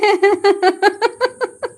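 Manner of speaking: high, bright burst of laughter. Real, not acted. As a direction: laugh out loud at the joke. (ज़ोर की हंसी)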